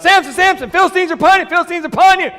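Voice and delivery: high, loud mock yell of alarm. Delilah yells to Samson that the Philistines have come, high and loud. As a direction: shout the warning up high. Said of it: "she yells out".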